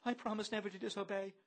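high, quick bleat. A narrator's reading. Bloom, enthralled, promises obedience, bleating.

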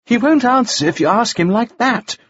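high-pitched objection; crying out in protest. A narrator's reading. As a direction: cry out to intervene. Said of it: "Frost cried".

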